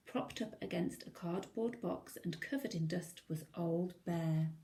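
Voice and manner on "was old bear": plain reading voice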